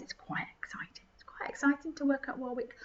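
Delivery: mock awed whisper.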